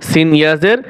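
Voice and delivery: usual speaking voice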